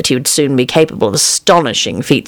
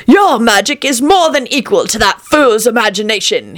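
higher-pitched dismissive assurance; scoffing charlatan; 7 semitones higher than usual. A narrator's reading. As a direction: scoff lightly at the worry. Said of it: "The charlatan scoffed".

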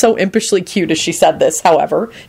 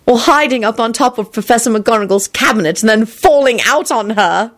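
higher, emphatic interjection; loudly added; 7 semitones higher than usual. A narrator's reading. Elizabeth chipping in on Lupin's detentions, high and loud. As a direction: pipe up loudly with a raised pitch. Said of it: "Elizabeth added loudly".

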